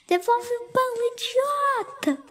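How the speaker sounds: high furious yell at the thief; mother charging at the bread thief; angry yell. A narrator's reading. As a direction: go for him, shouting.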